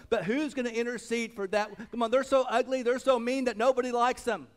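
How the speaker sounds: pressing hard on the congregation; fervent preaching. Real, not acted. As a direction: preach it loud and urgent. Preaches with full force.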